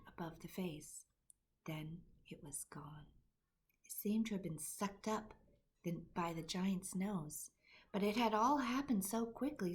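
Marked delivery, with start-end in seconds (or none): none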